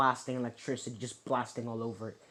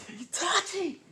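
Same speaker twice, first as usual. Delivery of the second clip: very high strained cry; acted high-pitched battle yell; enraged name call; slower than usual. Enraged name call in retold fight.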